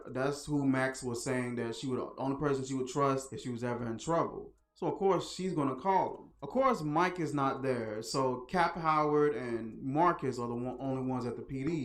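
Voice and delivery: ordinary talk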